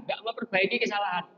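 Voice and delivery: ordinary delivery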